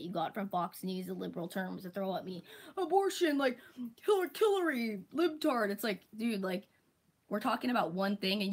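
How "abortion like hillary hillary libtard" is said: higher, louder derisive parroting of buzzwords; mocking dumb critic. Mocking online critics throwing buzzwords at her, higher and louder. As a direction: parrot their buzzwords like a brainless troll.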